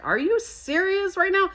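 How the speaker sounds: can't believe the offer; squeaky disbelief at the kindness; stunned disbelief. Retold or imitated. Surprised by free offer.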